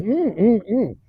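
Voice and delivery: closed-mouth mm of tasting; chewing hum. Shown as a demonstration. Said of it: "I had to just sit here going".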